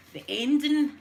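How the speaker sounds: high, loud burst of excitement; thrilled outburst. Retold or imitated. Telling viewers about The Sixth Sense ending, excited burst. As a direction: blurt it out like the twist just hit you.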